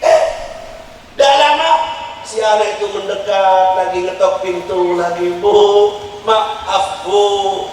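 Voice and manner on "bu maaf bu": put-on pleading child. Child begging mother at door.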